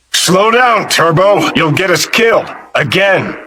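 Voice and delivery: high-pitched exclamation, easing slightly by the end; urgent warning. Acted. Game character warning reckless companion, urgent high-pitched call. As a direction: call out to rein someone in.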